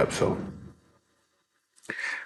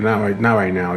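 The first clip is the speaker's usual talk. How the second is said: ordinary delivery, nothing marked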